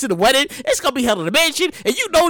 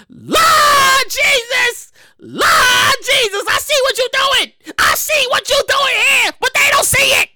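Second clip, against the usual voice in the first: exasperated Lord Jesus; 8 semitones higher, slower than usual. Real, not acted. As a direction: stretch it out to the heavens. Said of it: "host catchphrase".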